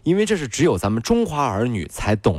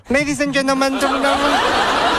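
尖高的英文广播模仿; 学机场广播报英文，音调拔高拖长; 英文广播腔; 12 semitones higher than usual. Acted. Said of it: "后面是英文". (搞笑的英文登机广播腔)

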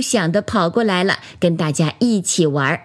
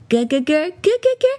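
high sing-out doll giggle, climbing higher; imitated giggle; 8 semitones higher, faster than usual. A narrator's reading. Storyteller imitates doll's frequent laugh, high mock giggle. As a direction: mimic a child's giggle in spoken syllables, rising. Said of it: "弟弟就经常笑".

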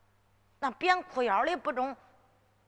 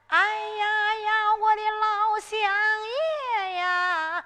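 high, slow drawn-out wail to the judge; wailing plea; 8 semitones higher, 4 dB louder, slower than usual. Shown as a demonstration. Girl showing how she'd plead before Judge Bao, a drawn-out wail. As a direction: cry out like a petitioner kneeling in court. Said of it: "那俺给你学学吧".

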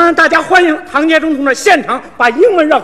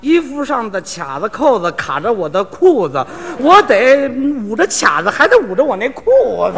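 drawn out slowly, word by word; slower mock English; slower than usual. Comic slows his fake English for his partner, deliberate and slow. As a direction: spell it out slowly for the listener. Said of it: "你再说慢一点儿".

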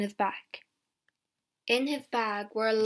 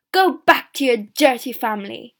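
angry shout; 11 dB louder than usual. A narrator's reading. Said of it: "he cried".

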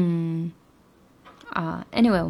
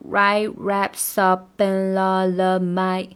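very slow, linked write raps up in all of my classes; very slow drill; 4 dB louder, much slower than usual. Shown as a demonstration. Teacher slowly drills the linking. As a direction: crawl through every link. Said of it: "要把它连读练清楚".

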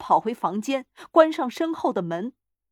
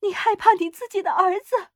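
high, loud, indignant cry; struggling shout; 11 semitones higher, 6 dB louder than usual. A narrator's reading. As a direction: cry out while struggling to get free. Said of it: "他挣扎着叫嚷". (挣扎着高声叫嚷)

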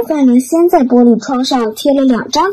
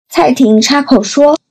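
ordinary delivery, nothing marked

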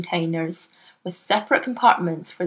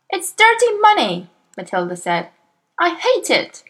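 put-on high child voice, shocked and reproachful; a little girl scolding her cheating father; indignant young Matilda; 10 semitones higher, 3 dB louder than usual. A narrator's reading. Matilda condemns the money.

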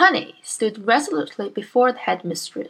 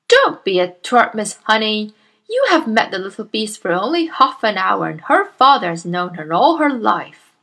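loud, booming bully of a headmistress; Miss Trunchbull; 5 dB louder than usual. Acted. Bullying headmistress scolds Miss Honey, booming and loud. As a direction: bark at the timid teacher like a tyrant.

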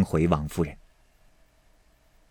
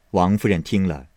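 usual speaking voice

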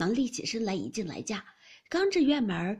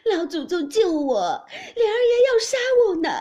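terrified wailing; 12 semitones higher, 12 dB louder than usual. A narrator's reading. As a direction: wail for help, stretching into a high cry. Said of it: "便哭着往贾母那边跑". (哭喊着高声求救)

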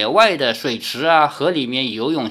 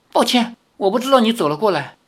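quick, higher-pitched apology; flustered; 6 semitones higher, faster than usual. A narrator's reading. Li apologising to the girl for an accidental touch, flustered. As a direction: hurry out a mildly panicked excuse. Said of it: "李逍遥慌忙说".

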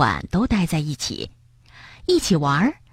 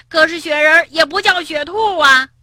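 higher, louder comeback; even louder; 8 semitones higher, 3 dB louder than usual. Acted. Frog shouting back even louder at the rabbit. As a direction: outshout your friend. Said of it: "跳跳的嗓门儿更大了".

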